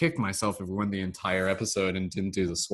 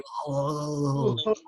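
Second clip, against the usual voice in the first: Austrian strongman chuckle; Arnold laugh; 6 semitones higher than usual. Shown as a demonstration. Host showing how Arnold laughs. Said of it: "this is how I laugh".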